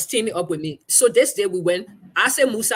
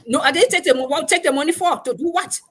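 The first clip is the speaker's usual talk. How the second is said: louder, higher mocking challenge; mock-outraged general; 9 semitones higher, 4 dB louder than usual. General mocking the reporter for refusing money, mock outrage. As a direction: toss the question back at her in disbelief.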